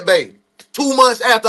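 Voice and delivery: ordinary talk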